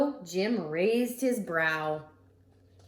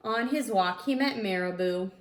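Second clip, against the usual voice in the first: usual speaking voice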